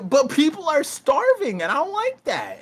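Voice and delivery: high, earnest Luffy voice; imitating Luffy. Retelling Wano, mimicking Luffy's simple outrage, high-pitched. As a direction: blurt it like an indignant kid hero. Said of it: "And Luffy's like".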